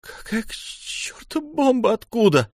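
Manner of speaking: exasperated high-pitched question; incredulous outburst. A narrator's reading. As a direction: demand an answer in shock. Alarmed quarrel over homemade bomb.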